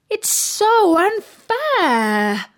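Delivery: high, very slow whine; whining. A narrator's reading. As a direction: drag out the complaint. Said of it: "she said out loud".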